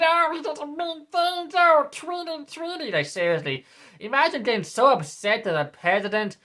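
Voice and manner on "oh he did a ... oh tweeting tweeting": whiny voice, much higher, mocking; mock offended voter. Mocking voters upset by mean tweets, in a high whiny voice. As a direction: squeak it like a wounded snowflake.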